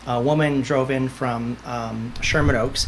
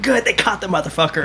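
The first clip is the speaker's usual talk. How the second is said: raised, excited cheer at the TV; mock shout; 6 semitones higher than usual. Medium recalls yelling at a true crime show, excited shout. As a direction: call it out gleefully. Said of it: "'cause I shout".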